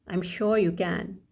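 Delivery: ordinary delivery, nothing marked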